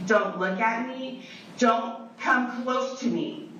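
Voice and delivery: slow, spaced-out commands, pitch climbing; stern warning. Real, not acted. Woman warning partner to keep away, stern and slow. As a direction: warn them off word by word, drawn out.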